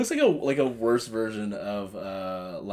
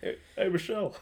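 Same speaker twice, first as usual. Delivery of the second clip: hammed-up presidential impression; Barack Obama; 9 semitones higher, 3 dB quieter than usual. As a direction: call out in a bad politician imitation. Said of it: "anyone's impression of Barack Obama".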